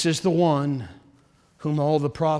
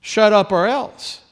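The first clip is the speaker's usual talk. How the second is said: mild threat; 5 semitones higher, 5 dB louder than usual. Retold or imitated. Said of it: "They're threatening him".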